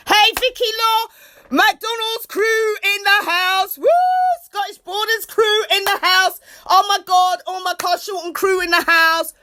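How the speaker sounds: high, loud, cheering greetings to viewers; excited shout-outs. Real, not acted. Streamer hyping up her viewers with loud cheers. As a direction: whoop and cheer for your fans.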